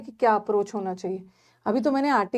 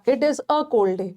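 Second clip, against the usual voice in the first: slow correct version; much slower than usual. Shown as a demonstration. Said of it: "correct form".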